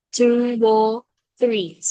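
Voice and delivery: very slow, drawn-out "Two row three"; "row" glided on from "Two", very slowly; linking sound. Shown as a demonstration. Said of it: "Nó đọc âm như thế này".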